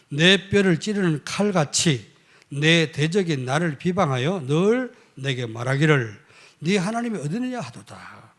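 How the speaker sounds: loud, slow, emphatic verse reading; preacher reading scripture. Acted. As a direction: declaim the verse with heavy, drawn-out emphasis. Reads the scripture verse aloud. (힘주어 읽는 성경 낭독)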